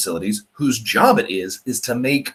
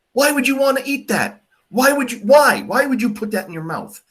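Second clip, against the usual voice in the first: much higher, disbelieving why, why, why; incredulous outrage; 12 semitones higher than usual. Real, not acted. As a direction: pitch it up in total disbelief at the idea. Teacher ranting about dirt wine.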